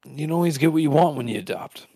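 ordinary talk, nothing marked

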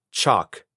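"Chalk" alone, short and low; first-half syllable demo. Shown as a demonstration. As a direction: model "Chalk" as chok, for repeating. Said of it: "repite después de mí". (sílaba "Chalk" aislada, en tono grave)

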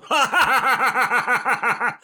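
high, loud cackle; manic villain laugh. Retold or imitated. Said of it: "manic laughing".

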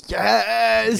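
excited yes. Retold or imitated. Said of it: "I was like".

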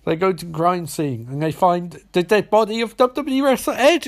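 ordinary talk, nothing marked

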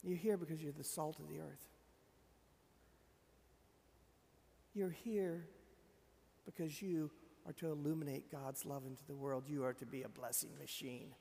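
Jesus speaking gently to his disciples, hushed and tender; gentle, intimate. Acted. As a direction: speak it close and gently, like a promise. Gentle voicing of Jesus' words.

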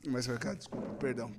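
soft, winding down after laughing; catching breath, apologizing softly; quiet apology. Real, not acted. Recompõe-se após rir. (desculpa baixinha após rir)

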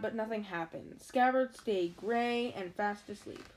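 plain reading voice

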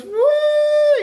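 loud, very high whistle "woo"; sustained high hoot like a dockyard whistle; imitated whistle. Retelling a comedian's docks joke, high loud whistle imitation. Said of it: "the whistle goes".

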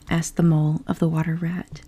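plain reading voice

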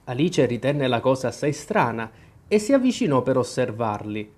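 ordinary delivery, nothing marked

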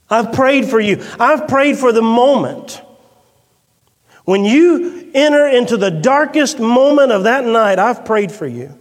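fervent sermon climax; impassioned preaching. Acted. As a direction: proclaim it with fire. Impassioned preaching of Jesus' words.

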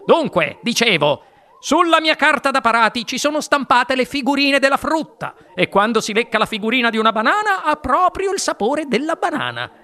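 high, animated explanation; eager inventor. A narrator's reading. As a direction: explain with rising enthusiasm. Wonka explains his invention. (inventore entusiasta, voce acuta e vivace)

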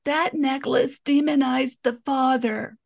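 high, grating imitation of the therapist; mock her whiny tone; mimicking an annoying psychologist. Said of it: "and this is how she said it, 'cause her voice is really annoying".

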